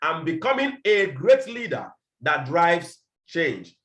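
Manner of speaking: each word of becoming laid out slowly; slow declaration. Shown as a demonstration. Leader modelling vision statement, slow and deliberate. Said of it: "saying after me".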